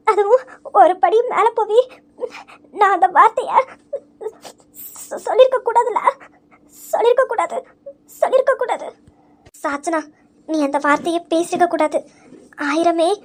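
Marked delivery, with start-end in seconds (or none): 0.1-8.9 higher, crying voice that climbs with each repeat; breaking into tears. Acted. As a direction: sob out the regret, rising as it repeats. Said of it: "நீ இப்ப அழுதும் எந்த பிரயோஜனமும் இல்ல". (உயர்ந்து உடையும் அழுகைக் குரல்)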